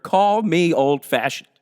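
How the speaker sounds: ordinary delivery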